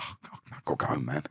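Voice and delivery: low, unvoiced aside; mumbled under the breath, low and quick; low mutter. Someone caught chatting on the way to the shop, low mutter. Acting a reluctant passer-by.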